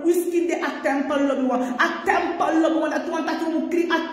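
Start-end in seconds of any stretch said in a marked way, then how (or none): none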